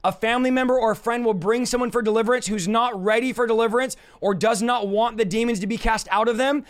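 usual speaking voice

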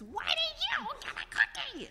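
very high, squeaky pigeon question; pigeon asking. Acted. Pigeon quizzes duckling, very high. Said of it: "the pigeon asks".

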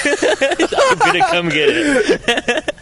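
squeaky laugh through a quote; can barely get the words out for laughing. Real, not acted. Laughing at the absurd text.

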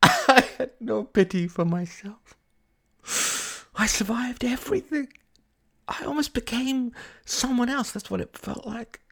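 high, wailing words with sobbing laughs; high, overwrought crying voice; tearful breakdown. Acted. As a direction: pour it out, close to hysterics. Tearful breakdown to her friend.

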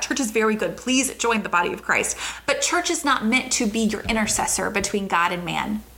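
ordinary talk

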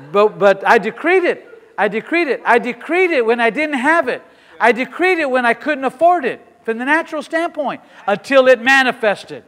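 loud, rising preacher's cry; fiery preaching. Real, not acted. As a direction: build the decree like a revival preacher. Preaching at full force.

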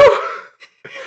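short unvoiced burst of laughter; breathy laugh. Real, not acted. Laughing at the brother's boast, breathy laugh.

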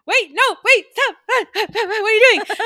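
high, loud panicked cries of wait and stop; frantic shouting at the story; alarmed yelling. Retold or imitated. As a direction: yell at the screen in alarm. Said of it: "I'm like".

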